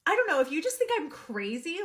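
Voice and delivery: ordinary talk, nothing marked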